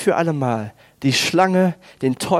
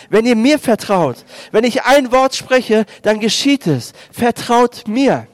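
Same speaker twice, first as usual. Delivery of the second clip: Jesus proclaiming; 5 semitones higher than usual. Acted. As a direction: speak as Jesus calming the storm, with full authority. Jesus spricht zu den Jüngern. (kraftvoll verkündende Jesus-Stimme)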